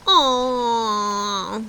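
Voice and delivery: drawn-out groan of letdown; disappointed groan. A narrator's reading. Children groaning as the tour ends, mild groan. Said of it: "groaned the children".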